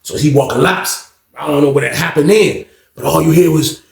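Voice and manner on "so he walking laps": usual speaking voice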